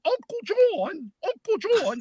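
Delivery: put-on high, slow child voice with a British accent; little nephew's British accent. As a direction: imitate a small boy with a Peppa Pig British accent. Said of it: "That's how he would talk".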